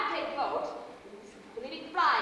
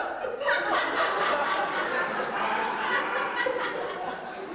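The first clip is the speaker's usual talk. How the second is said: giddy laugh; 4 semitones higher than usual. Acted. Cedric stutters on the phone.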